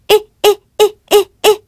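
high, quick "Eh" giggles; pig giggle. Shown as a demonstration. Tickled pig giggling in high little bursts. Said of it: "This is the sound of her giggling".

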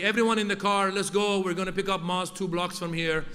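put-on loud Persian-accented dad rounding up the family. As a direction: bark the orders in a thick Persian accent, building to a shout. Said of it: "my dad would be".

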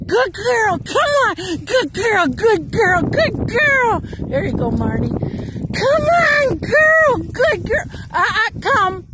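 high-pitched loud dog praise; sing-song calling the dog; squeaky praising a dog. Real, not acted.

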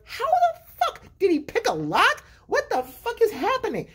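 exaggerated baffled astonishment; mock bewilderment. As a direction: squeal it in total disbelief. Baffled by baby escaping a cage.

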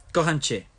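"Kocham cię" dicho en polaco, acentuando "Kocham". Shown as a demonstration. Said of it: "that's Polish". ("Kocham cię" en polaco, con fuerza)

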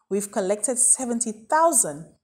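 ordinary delivery

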